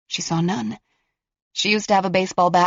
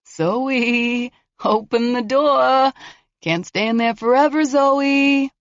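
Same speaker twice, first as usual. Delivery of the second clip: higher, mocking taunt; taunting killer; 6 semitones higher, 3 dB louder, slower than usual. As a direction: sneer at her bluff. Killer coaxing at door.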